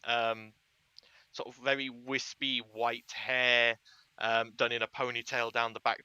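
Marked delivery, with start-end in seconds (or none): none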